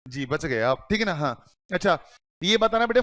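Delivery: ordinary talk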